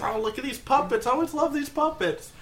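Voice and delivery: high, giddy excitement; gushing high over a beloved toy; like a child. Acted. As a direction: squeal with childlike delight. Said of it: "He's like a child".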